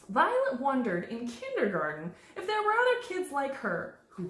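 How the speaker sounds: slow, lilting picture-book narration; measured storytime voice with lifted phrases; reading to children. A narrator's reading. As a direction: read to little ones, swinging the key words.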